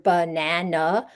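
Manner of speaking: "banana" slowly, lazy schwa in unstressed syllables. Shown as a demonstration. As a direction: sound out "banana" slowly, weak first and last vowels. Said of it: "pronounced as the schwa".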